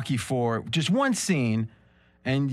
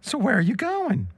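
higher mock Adrian; 6 semitones higher than usual. Adrian asking Rocky where he goes, higher. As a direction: lift the voice as Adrian. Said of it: "I'm stuck with Adrian".

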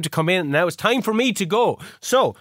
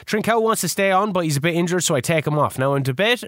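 ordinary talk, nothing marked

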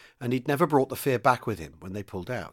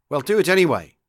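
higher, louder attempt at a firm order; insist, forcing some sternness; trying to sound stern; 4 semitones higher, 7 dB louder than usual. A narrator's reading. Young commander overruling a reluctant crewman, trying to sound stern. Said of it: "tried to make his voice sound stern".

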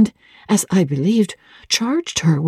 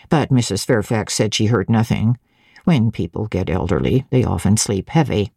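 lower, level voice of a stolid servant; stolid servant woman; 8 semitones lower than usual. A narrator's reading. Grace Poole calmly explains the fire to Jane, low and stolid. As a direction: keep it low and unbothered, giving nothing away.

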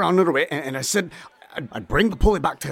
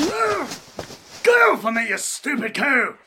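high, slow yell of struggle; angry shout; 11 semitones higher, slower than usual. Acted. Captured thug shouting at his captors. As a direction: fight them off, shouting.

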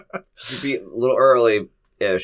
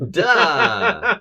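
high, loud mocking duh; 14 semitones higher, 6 dB louder than usual. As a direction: throw out a sarcastic duh. Mocking obvious point.

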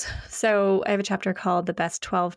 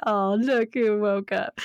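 slow, fond coo; doting parent's greeting; slower than usual. Retold or imitated. Mother to kids when they get up, doting. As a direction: draw it out adoringly. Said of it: "I actually say that to my kids".

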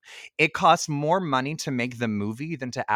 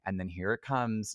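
usual speaking voice

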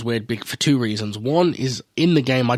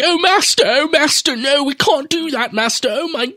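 whiny servant voice; 13 semitones higher than usual. Retold or imitated. Mocking the devoted anime butler, in a high whiny servant voice. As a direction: beg your master in a shrill whine. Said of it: "annoyingly high-pitched voice".

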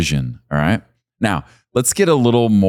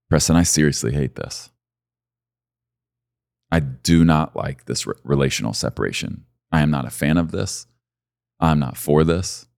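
God's grieving voice; 4 semitones lower, 3 dB quieter than usual. Acted. God's voice in sermon, tender.

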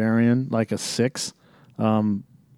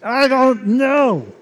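mock movie echo; 13 semitones higher, 5 dB louder, much slower than usual. Echoing the movie clip.

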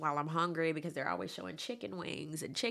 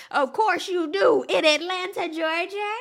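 high, loud sassy emphasis; sassy loud exclaim; 9 semitones higher, 7 dB louder than usual. Real, not acted. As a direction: throw it out with attitude. Sassy exaggerated reaction.

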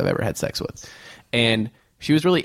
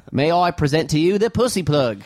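usual speaking voice

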